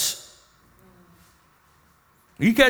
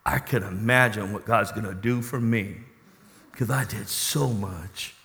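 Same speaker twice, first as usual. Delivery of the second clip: boastful man in line; 8 semitones lower, 7 dB quieter than usual. Acted. Man in line bragging to others.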